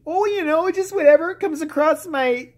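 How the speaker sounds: high, slow airheaded drawl; a hammed-up high ditzy politician; ditzy Sarah Palin. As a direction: play her as a fumbling airhead. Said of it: "what do you read".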